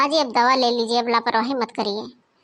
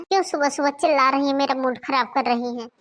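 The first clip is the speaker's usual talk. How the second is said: ordinary talk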